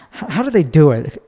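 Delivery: usual speaking voice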